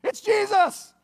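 loud, high-pitched shout; excited reveal. Real, not acted. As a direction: shout the big surprise. Preacher reveals surprise on beach.

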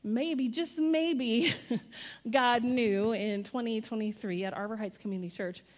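ordinary delivery

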